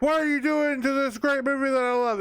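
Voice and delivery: high, indignant fanboy wail; mock outraged fan. Reviewer mocking a fan defending a beloved film, indignant and high.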